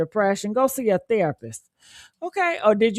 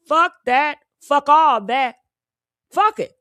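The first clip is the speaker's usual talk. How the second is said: angry; 4 semitones higher, 4 dB louder than usual. Real, not acted. Escalating angry rejection.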